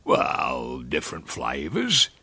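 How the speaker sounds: low, offhand rustic reply; airy. A narrator's reading. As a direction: toss it off airily. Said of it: "and he said airily".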